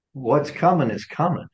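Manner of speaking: ordinary talk, nothing marked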